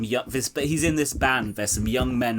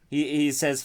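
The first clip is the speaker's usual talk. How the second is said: normal talking voice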